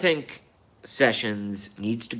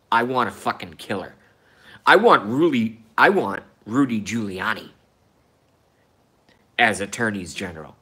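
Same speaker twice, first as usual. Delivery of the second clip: ordinary talk, nothing marked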